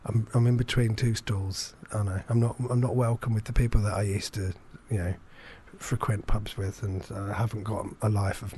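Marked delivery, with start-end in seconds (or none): none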